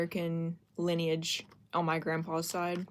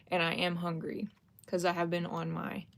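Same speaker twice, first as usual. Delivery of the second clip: ordinary talk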